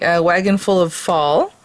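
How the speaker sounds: ordinary delivery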